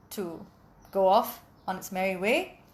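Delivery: ordinary delivery, nothing marked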